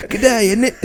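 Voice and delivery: high mock English-Australian voice; English-Australian mixture. Co-host teases the Australian character, high mock English-Australian accent. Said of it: "The English Australian".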